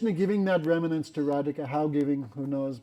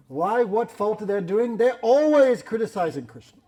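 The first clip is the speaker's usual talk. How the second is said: higher and louder, slowed protest; indignant outburst; 6 semitones higher, 6 dB louder than usual. Acted. Kutila overhearing the snake, protesting higher and louder. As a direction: bristle at the accusation.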